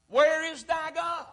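high, drawn-out question; slow jeering call from the crowd; taunting challenge. Acted. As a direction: raise the pitch and stretch the taunt. Crowd's taunt voiced by preacher.

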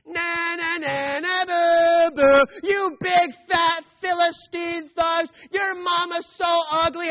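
put-on loud, childish playground jeer; hammed-up na-na boo-boo jeering at the Philistines; mock taunting. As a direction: taunt the enemy like a schoolkid.

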